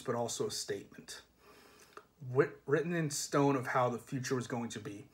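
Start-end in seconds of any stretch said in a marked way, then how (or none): none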